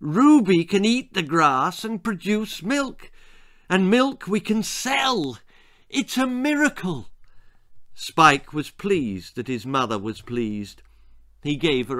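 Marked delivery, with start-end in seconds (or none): 0.0-7.1 delighted. A narrator's reading. Mother overjoyed.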